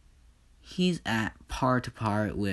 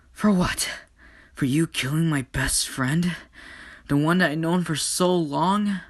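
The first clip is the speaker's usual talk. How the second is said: higher and louder, hurt and furious; angry yelling; 7 semitones higher, 4 dB louder than usual. Acted. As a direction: shout back at him in grief and rage. Sister yelling at brother.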